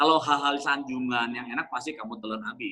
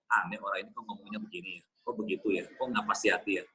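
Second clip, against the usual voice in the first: muttered inner thoughts; 8 semitones lower, 6 dB quieter, faster than usual. Retold or imitated. Inner grumbling at a critic.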